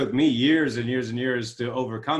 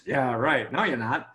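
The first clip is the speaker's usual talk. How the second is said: 1 sceptical brain; 2 semitones lower than usual. Retold or imitated. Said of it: "your brain says".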